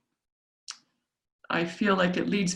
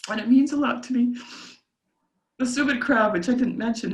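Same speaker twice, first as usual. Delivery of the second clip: higher voice, still close to tears; tearful; 5 semitones higher than usual. Real, not acted. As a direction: push on through welling emotion. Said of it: "I'll just cry at this".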